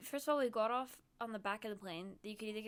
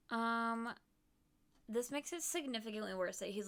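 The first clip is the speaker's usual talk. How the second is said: usual speaking voice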